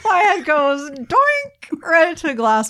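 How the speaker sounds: high, laughing through words; laughing while talking. Real, not acted. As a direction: giggle the words out. Laughing while telling the bump.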